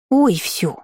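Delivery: dismissive lisping gargoyle huff; snorting huff. Acted. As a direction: brush it off with a snort. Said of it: "фыркнула нечисть". (фыркнуть пренебрежительно)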